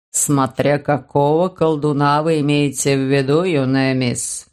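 put-on low, slow elderly gentleman; a sly old notary musing. Bald notary speaks.

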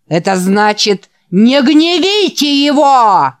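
loud, high, slow warning; yelled into the mic. Acted. The preacher yells into the microphone at the boys. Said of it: "заорал в микрофон".

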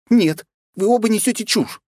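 high, loud angry shout. Acted. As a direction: yell at both of them. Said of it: "закричала Света". (крик)

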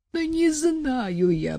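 high, slow, near tears; frightened prophet pleading, tearful. Acted. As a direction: wail it out, on the edge of crying. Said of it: "плачущим голосом сказал пророк".

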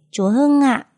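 put-on high, polite small-child answer; little boy. A narrator's reading. Son telling his mother who taught him, high child voice. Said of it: "cái giọng ngọng líu ngọng lo".

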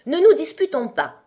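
high, loud, anxious plea. A narrator's reading. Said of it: "dit la Reine Blanche d'une voix anxi".

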